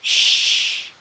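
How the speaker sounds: whispered shh; shushing. Retold or imitated. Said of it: "goes to the audience and goes".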